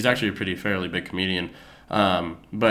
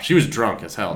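ordinary talk, nothing marked

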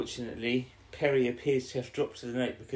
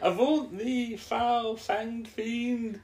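high, theatrical, drawn-out cry; hammed-up exorcist; 12 semitones higher, 4 dB louder, much slower than usual. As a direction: ward off evil in melodramatic mock proclamation. Acting character warding off the Doctor.

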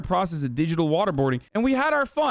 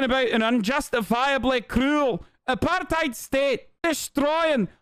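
high, hammed-up Scottish accent; Eve Fartlow, Scottish accent; 9 semitones higher than usual. Streamer ranting about an apartheid state, high mock Scottish accent. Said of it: "were not expecting her actual accent".

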